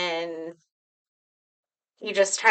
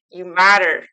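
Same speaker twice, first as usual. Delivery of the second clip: louder, a firm declaration; 9 dB louder than usual. Shown as a demonstration. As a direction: proclaim it so they believe it. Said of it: "I want you to say it loud".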